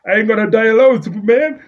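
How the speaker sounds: loud, high villain yell; villain shout. Retold or imitated. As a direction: bellow it like a cornered villain. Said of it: "Then he's like".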